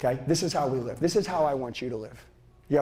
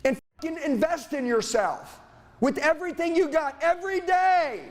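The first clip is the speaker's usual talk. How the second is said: higher and slowing, hammering each command; preaching at full force; 8 semitones higher, slower than usual. Acted. Speaker rallying a seminar crowd to change, preaching at full force. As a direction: preach it to the room like a coach. Fired-up motivational push.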